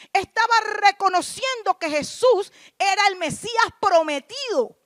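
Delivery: predicar a plena voz, golpeando las palabras clave; prédica enérgica. Real, not acted. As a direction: proclama con fuerza ante la congregación. Preaches at full force. (prédica fuerte y enfática)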